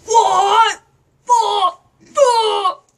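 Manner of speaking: loud startled swearing; shocked shouting. Real, not acted. Startled by the puppet, shouting out loud. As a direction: yell it out in real shock.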